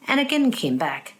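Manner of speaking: usual speaking voice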